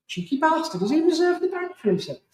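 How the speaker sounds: high, incredulous outburst; amused disbelief. Retold or imitated. Hearing the friend's news, high amused disbelief. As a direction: rise up in mock outrage.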